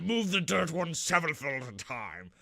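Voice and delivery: slow, drawn-out haughty lord; pompous aristocrat. Acted. Boss demanding his profit from workers, slow pompous aristocrat. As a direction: stretch every word like a greedy mock aristocrat. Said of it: "sound like an even bigger aristocrat".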